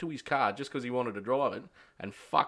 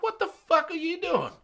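high-pitched mock disbelief; incredulous outcry. Confronting a workmate over jelly beans, incredulous outcry. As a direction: demand an answer in a high, baffled voice.

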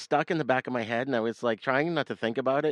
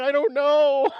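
sheepish answer; 9 dB louder, slower than usual. Retold or imitated. His helpless answer.

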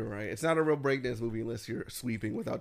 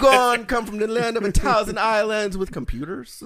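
loud, higher mock Jamaican that falls apart; slipping Jamaican accent; 7 semitones higher, 10 dB louder than usual. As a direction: start the Jamaican accent strong, then let it crumble. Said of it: "the words you know how to say".

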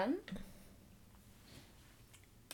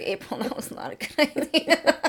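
stern self-talk; 7 semitones higher, 4 dB louder than usual. Retold or imitated. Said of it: "I coached myself in the mirror".

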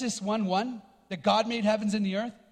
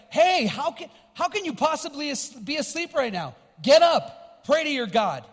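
higher, louder, panicked captain shaking him awake; frantic captain; 3 semitones higher, 4 dB louder than usual. Retold or imitated. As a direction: shout him awake mid-storm. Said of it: "he's like".

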